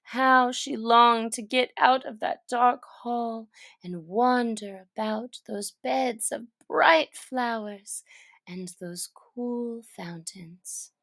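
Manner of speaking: plain reading voice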